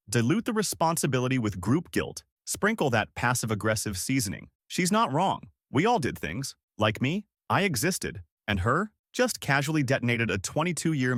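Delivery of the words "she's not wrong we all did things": usual speaking voice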